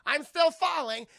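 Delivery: high, loud mock scream; panicked yelling. Lois Lane calling out as she falls, hammed-up yelling. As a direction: yell it out as if plummeting. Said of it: "Lois Lane's like".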